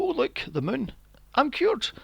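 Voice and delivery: high, sarcastic sudden delight; mock epiphany. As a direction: mimic an absurdly easy revelation, pitch rising. Mocks villain's sudden change.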